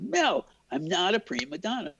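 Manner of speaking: high, mild mock yell; retold scream. Cameraman answers director over the phone, high mock scream. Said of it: "I screamed into the phone".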